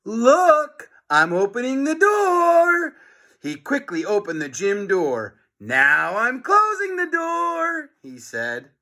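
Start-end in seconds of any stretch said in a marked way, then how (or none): 0.0-3.0 high, loud mocking taunt; sing-song teacher teasing. 5.6-7.9 high, slow mocking tease; taunting teacher.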